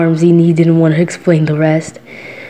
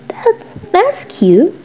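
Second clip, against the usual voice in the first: delighted; 8 semitones higher than usual. Acted. [name] charmed by listener's name idea. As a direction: melt at the name.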